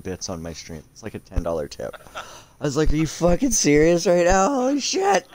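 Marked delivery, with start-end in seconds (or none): 2.9-5.3 loud, high-pitched astonished outburst; reenacted disbelief. Streamer retelling a thousand-bit donation, loud astonished disbelief. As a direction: replay your shock, rising to a higher peak.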